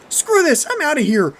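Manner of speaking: loud, high, frantic shout; panicked yell. A narrator's reading. Bo fleeing his friends, yelling in panic. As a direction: yell it as you bail out.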